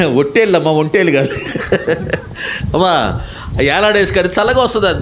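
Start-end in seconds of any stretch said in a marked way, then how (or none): 0.1-3.2 amused correction. Real, not acted. Corrects a funny mishearing, chuckling.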